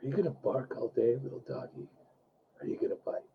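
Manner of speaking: low, near-whispered menacing taunt; Mister Blonde impression. Shown as a demonstration. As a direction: breathe the threat almost without voice. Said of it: "Let's have you say it".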